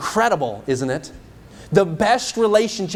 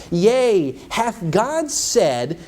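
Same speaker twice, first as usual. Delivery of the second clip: serpent's question; 5 semitones higher, much slower than usual. Acted. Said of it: "and he said unto the woman".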